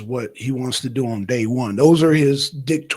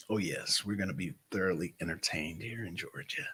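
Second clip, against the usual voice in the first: whispered, savouring the juicy scandal; gleeful whisper; 7 semitones lower, 16 dB quieter than usual. Real, not acted. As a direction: lean in and whisper it with relish. Gleeful whisper about Georgia scandal.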